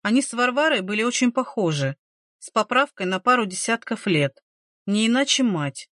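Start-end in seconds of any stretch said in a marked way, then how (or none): none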